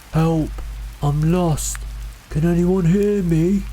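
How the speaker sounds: high, drawn-out desperate call; desperate, frightened. A narrator's reading. Lost man to unseen hikers, desperate frightened call. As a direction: call out high, pleading to be found. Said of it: "The voice sounded desperate, frightened".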